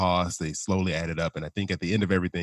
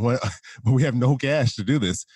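laughing; 6 semitones higher, 5 dB louder than usual. Real, not acted. Laughs retelling wedding costs.

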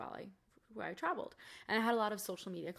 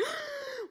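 panicked gasp. Retold or imitated. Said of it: "and I was just like".

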